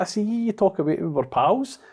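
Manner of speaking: high, whiny mock of the troll's complaint. Quoting drunk ex-employee troll.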